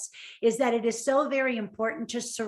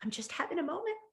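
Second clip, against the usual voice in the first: much softer, as if holding back tears; quoted friend, hushed; 5 semitones higher, 9 dB quieter, faster than usual. Retold or imitated. Friend on the phone, quietly overwhelmed. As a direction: let it barely come out. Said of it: "she's like".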